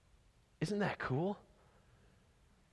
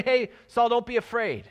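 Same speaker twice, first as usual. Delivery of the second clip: a light, casual hey, louder and higher; breezy mock greeting; 5 semitones higher, 4 dB louder than usual. Preacher mocking a too-casual Jesus, breezy and bright. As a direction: toss it off like a cheery hello. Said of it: "Jesus doesn't say".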